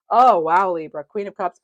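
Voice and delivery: loud, genuine wow of surprise; amazed exclamation. Real, not acted. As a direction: burst out loudly, truly impressed. Reacts to newly drawn card.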